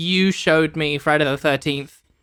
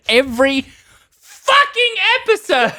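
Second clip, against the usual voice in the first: high, loud, laughing outburst; exasperated laughing cry; laughing exasperation; 13 semitones higher, 5 dB louder than usual. Real, not acted.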